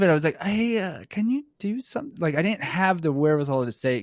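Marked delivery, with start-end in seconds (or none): 0.4-2.2 high, timid little request; naive young self. Retold or imitated. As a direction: ask the boss meekly, far too politely. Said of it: "I was so young and naive".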